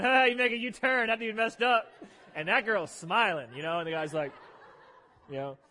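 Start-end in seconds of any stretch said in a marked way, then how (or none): 0.0-1.9 louder mock taunt from onlookers; mild mock laugh from the whole town; mocking jeer. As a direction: jeer at the U-turn. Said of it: "everybody in town's, like, laughing".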